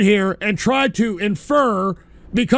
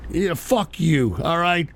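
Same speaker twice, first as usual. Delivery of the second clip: ordinary talk, nothing marked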